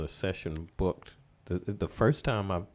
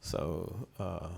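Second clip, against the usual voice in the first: ordinary talk, nothing marked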